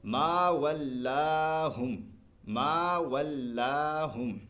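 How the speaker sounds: ما ولاہم recited very slowly with ما and لا held long; slow tajweed recitation; stretched ma and la. Shown as a demonstration. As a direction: hold ما and لا long, chanting. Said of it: "تو یوں پڑھیں گے ہم".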